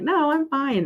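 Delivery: imitating niece. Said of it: "'Cause she's like".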